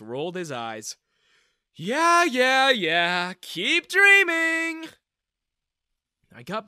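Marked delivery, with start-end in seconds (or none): 1.7-4.9 loud, high, drawn-out mock; mocking dismissal. Daniel brushes off the girl's threat, loud mockery. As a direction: wave her off with scorn.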